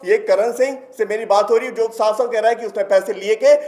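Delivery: ordinary delivery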